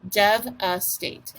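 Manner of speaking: DEVASTATE slowly as dev, a, state; three separated beats on devastate, v closing the first; split into syllables. Shown as a demonstration. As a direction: break devastate up for a speller. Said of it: "let's do our syllables".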